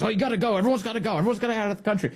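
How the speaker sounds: high, insistent mock rant; retold frantic posting. Host mocks the secretary's posts, high insistent rant. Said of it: "He goes".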